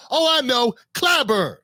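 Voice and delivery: ordinary delivery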